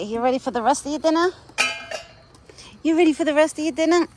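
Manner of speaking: high, loud sing-song question to the animal; excited pet talk. Real, not acted. Cooing at the deer at dinner time, high and loud. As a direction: hype him up for dinner like a beloved pet. Talking sweetly to a feeding animal.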